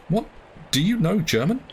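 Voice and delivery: startled question that jumps up high; surprised cry. Acted. Narrator cries out to his servant in surprise, high and startled. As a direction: blurt it out in disbelief. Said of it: "I cried".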